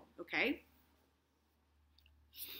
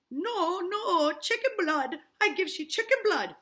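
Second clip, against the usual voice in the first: lightly voiced Caribbean accent, high; a light Barbados lilt; a hint of Tituba's Caribbean lilt; 10 semitones higher, 10 dB louder than usual. Tituba pleading with the minister in a light Caribbean accent. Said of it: "Tituba's Barbados voice".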